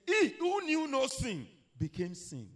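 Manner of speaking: high, loud, slow declaration; preacher's proclamation. Acted. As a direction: proclaim the verse at full force. Preacher proclaiming a scripture quote.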